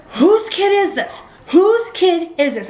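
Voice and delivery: high, drawn-out demand to the room; retold indignant call, pitched well up; calling out indignantly. Mother demanding whose kid this is, calling out indignantly.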